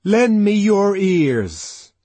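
impassioned appeal. A narrator's reading. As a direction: warn them with all his heart. Savage addresses the crowd.

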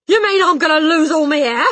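high, very loud outburst; panicked yell. A narrator's reading. Husband fearing baldness, panicked yell. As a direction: yell it in disbelief. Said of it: "the husband yelled".

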